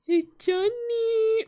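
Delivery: high, drawn-out character voice; character impression. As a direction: stretch it out in a high put-on voice. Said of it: "I love doing his voice".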